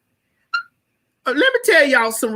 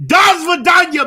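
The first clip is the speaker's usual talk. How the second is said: shouted mock Russian; 8 semitones higher, 5 dB louder than usual. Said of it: "he gonna say".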